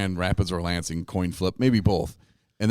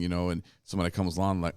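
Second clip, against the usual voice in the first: ordinary talk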